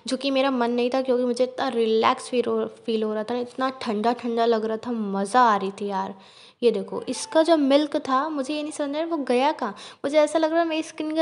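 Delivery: mask peeling off face; speaking behind thin sheet; talking through sheet mask. Real, not acted. Seen 2: Wet sheet mask being peeled, hanging over mouth while speaking.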